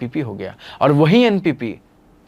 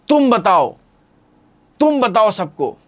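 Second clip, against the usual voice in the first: higher, louder insistence; eager urging; 7 semitones higher, 4 dB louder than usual. Retold or imitated. Terrestrial eagerly begging teacher to tell everyone. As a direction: urge him to tell everyone.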